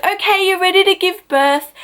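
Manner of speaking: high, singsong midwife announcement; imitated midwife. Midwife telling the labouring mother it is time, high imitated voice.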